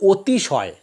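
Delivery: অতিশয় with the first vowel said as ও, otishoy; অতিশয় উচ্চারণ অতিশয় নয়, ওতিশয়; spoken as otishoy. Shown as a demonstration. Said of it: "অ এর পরিবর্তে ও উচ্চারণ করি".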